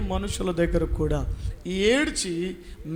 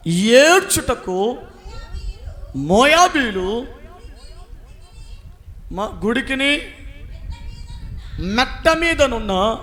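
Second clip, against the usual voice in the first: slow, drawn-out, louder reading of the verse; slow scripture reading; 3 semitones higher, 5 dB louder, slower than usual. Acted. Preacher reading Isaiah to the church, slow and solemn. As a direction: give each word of the verse its own weight.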